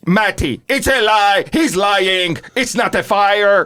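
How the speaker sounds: high, insistent calling out; imitated frantic shout. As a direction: re-enact yelling after someone running away. Girl calling after her fleeing sister.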